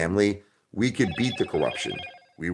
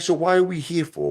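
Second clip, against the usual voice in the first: indignant disbelief; 12 semitones higher than usual. Retold or imitated. Retelling his reply to producer.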